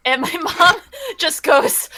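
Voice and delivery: usual speaking voice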